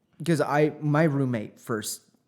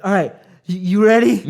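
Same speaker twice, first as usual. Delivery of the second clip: louder, higher, mock-rallying a friend; hyped-up drunk retelling; 8 semitones higher, 11 dB louder, faster than usual. Friend rallying drunk friend to walk back, hyped up. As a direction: call it out like a wobbly pep talk.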